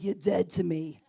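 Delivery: low mock-stern father; stern father impression. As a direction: drop low like a disowning father.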